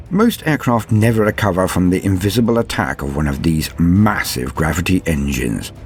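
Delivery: normal talking voice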